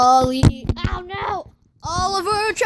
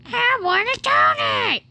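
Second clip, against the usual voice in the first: higher pleading pet voice; whiny dog voice; 6 semitones higher than usual. Pretend. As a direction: beg like a greedy dog. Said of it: "what are your thoughts right now".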